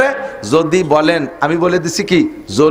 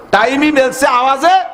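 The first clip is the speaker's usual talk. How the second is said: emphatic shout; 8 semitones higher than usual. Real, not acted. Emphatic argument climax. (জোর গলায় চিৎকার করে বলা)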